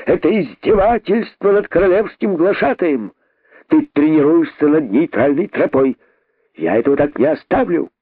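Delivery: high, ranting indignant herald; pompous, high-pitched tirade; outraged cry. Acted. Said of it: "закричал находчивый".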